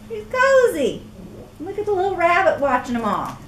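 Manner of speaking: delight in it. Real, not acted. Reader delights at the cozy caterpillar, high and bright. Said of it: "reader delighted aside".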